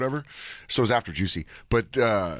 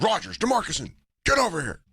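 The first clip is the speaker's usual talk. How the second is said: higher, barking coach calling players over; coach calling names; 9 semitones higher than usual. Pretend. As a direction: call out names like a dazed coach.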